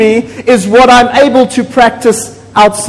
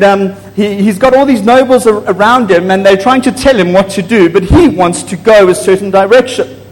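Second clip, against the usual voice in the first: ordinary delivery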